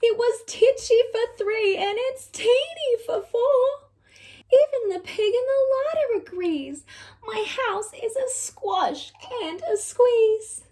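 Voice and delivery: high, wailing plea; an old woman at her wits' end; crying old lady. Acted. Lady cries out about her crowded house, high and wailing. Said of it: "The little old lady cried".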